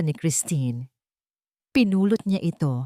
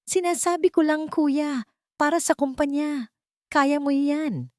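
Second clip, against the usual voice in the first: higher, needling brother voice, a touch of mock support; sly younger brother; 9 semitones higher than usual. George needling Gabriel about the company, lightly sly and higher. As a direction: hide the jab under fake encouragement.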